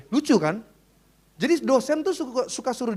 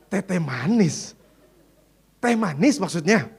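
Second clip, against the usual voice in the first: puzzled echo; 5 semitones lower, slower than usual. Retold or imitated. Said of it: "kan aneh".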